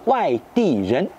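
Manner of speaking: Wai Di Ren spoken slowly in Mandarin with its tones; Mandarin term. Shown as a demonstration. Teacher giving French viewers the Chinese term, slow and careful. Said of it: "des gens de l'extérieur".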